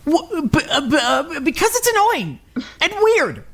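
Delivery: high-pitched embarrassed stammer; flustered. Acted. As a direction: caught caring, squirm out of it. Flustered after showing care.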